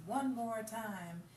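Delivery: drawn-out quote. Retold or imitated. Quoting the [name] record, slow and drawn out. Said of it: "'Cause he says".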